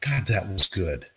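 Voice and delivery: slow, soft and quiet; soft, satisfied sigh. Retold or imitated. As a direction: savor it like a great coffee. Voices a satisfied customer.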